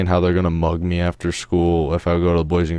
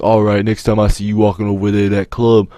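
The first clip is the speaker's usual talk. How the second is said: louder mock tough-guy threat; retold threat; 3 semitones higher, 7 dB louder than usual. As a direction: imitate a kid threatening a beating. Friends threaten him in retold quarrel.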